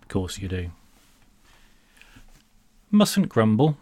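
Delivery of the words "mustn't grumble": brisk, chin-up British resignation; stiff upper lip. Acted. Sardonic British stock phrase.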